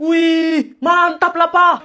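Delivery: high, loud admiration; wowed and cheering; impressed cheer. Acted. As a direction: praise with the pitch lifted. Child cheers father's boast.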